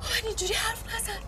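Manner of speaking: tearful outburst. Acted. Wife cries at husband not to talk to her that way. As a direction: voice breaking then rising to a cry.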